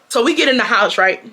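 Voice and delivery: ordinary delivery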